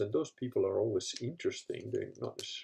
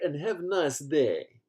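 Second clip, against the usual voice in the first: put-on slow, heavy Russian accent; mock Russian sign-off, slow and heavy; Dmitry Popov Russian accent; 5 semitones higher, 5 dB louder, much slower than usual. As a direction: drag it out in a thick Russian accent. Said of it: "the Russian accent".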